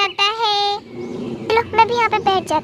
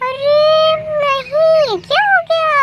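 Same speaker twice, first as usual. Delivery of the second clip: horrified dismay; 8 semitones higher, 4 dB louder than usual. Acted. Boy seeing his bearded reflection, horrified dismay. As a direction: wail it high in dismay.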